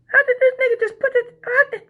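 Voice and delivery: squeaky, stammering astonishment; mock disbelief. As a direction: imitate stunned dancers, voice shooting very high. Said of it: "and they're like".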